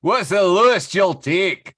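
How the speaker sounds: "What's the lowest you'll take" in a loud, high Scottish brogue; Scottish accent. Acted. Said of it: "Scottish voice for Michael".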